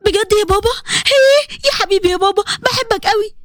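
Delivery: high, delighted squeal of thanks; overjoyed. Acted. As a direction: burst with a daughter's joy. Daughter hears father's permission.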